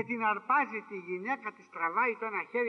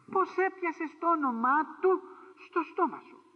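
slow, high, louder reproach; stern rebuke; 7 semitones higher, 3 dB louder, slower than usual. Acted. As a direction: scold him slowly for daring to speak. Preacher rebuking the accuser. (αυστηρή επίπληξη, αργά και δυνατά)

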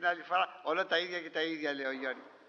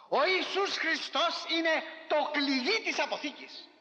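higher, slow proclaimed preaching cry; preaching shout; 6 semitones higher, slower than usual. Real, not acted. Preacher to congregation, slow proclaiming shout. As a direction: proclaim it like the heart of the sermon.

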